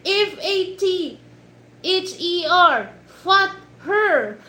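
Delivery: high, loud, slow mock spelling; mad dad. Mad dad spelling for his daughter, hammed-up exasperation. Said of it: "he's just so mad with, with his daughter".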